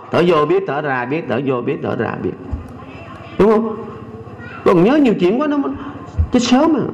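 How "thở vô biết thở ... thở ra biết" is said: calm guidance. Shown as a demonstration. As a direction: guide the breath gently. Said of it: "thầy muốn chỉ cho vị cách".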